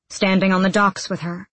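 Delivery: ordinary delivery, nothing marked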